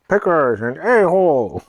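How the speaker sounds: put-on high, slow, loud little-kid voice; imitated little sister. Imitating her sister cussing at the cat, high kid voice. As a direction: draw it out high like a small child. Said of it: "She was just like".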